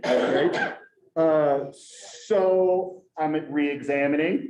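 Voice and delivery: ordinary talk, nothing marked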